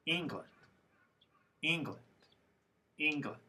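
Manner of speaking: England with a long first syllable, ENG-land; lengthened stressed vowel. Shown as a demonstration. Teacher modelling vowel length for students, stressed first syllable in England. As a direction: stretch ENG in England, let land drop away. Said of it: "These sounds should sound a little longer".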